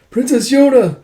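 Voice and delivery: high, loud cry; alarmed calling out. Acted. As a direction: shout after the vanished princess. Alarmed call for vanished princess.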